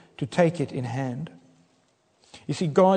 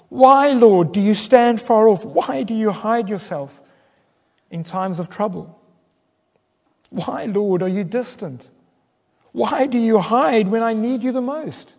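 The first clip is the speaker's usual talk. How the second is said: higher, louder anguished pleading; desperate cry; 5 semitones higher, 6 dB louder than usual. Acted. Preacher voicing David's desperate cry to God. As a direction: cry out to a distant God in despair. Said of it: "It's a cry of, of utter desperation".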